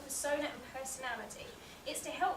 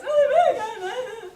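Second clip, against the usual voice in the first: high, loud, slow drawn-out groan; hammed-up whine through laughter; mock moaning; 10 semitones higher, 18 dB louder, much slower than usual. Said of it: "that voice that you just did".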